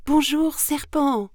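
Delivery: high, bright-pitched greeting; high mouse hello, loud at first; mouse calling out. Acted. Mouse hails the snake.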